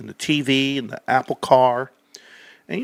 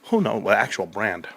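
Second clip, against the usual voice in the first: normal talking voice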